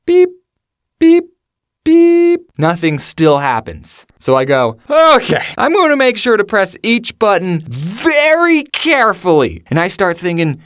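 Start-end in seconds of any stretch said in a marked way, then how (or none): 0.1-2.4 short high electronic beeps; high beeps, the last held longer; imitated keypad beeps. 4.9-9.6 high, drawn-out, emphatic self-instruction; overdone careful determination; mock exasperated resolve. Acts exasperated second careful try.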